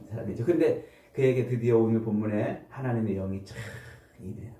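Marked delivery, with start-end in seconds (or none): none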